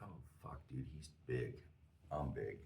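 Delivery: very soft, low mutter of dread; retold thought, barely voiced; hushed alarm. Locking eyes with a big stranger, hushed alarm.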